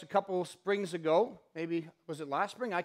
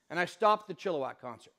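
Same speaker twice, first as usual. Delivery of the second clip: normal talking voice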